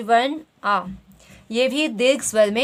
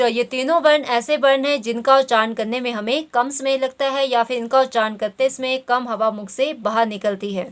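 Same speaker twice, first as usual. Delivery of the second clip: ordinary talk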